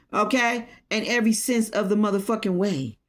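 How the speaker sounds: ordinary talk, nothing marked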